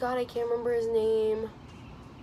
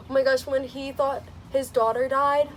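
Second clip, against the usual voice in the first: ordinary talk, nothing marked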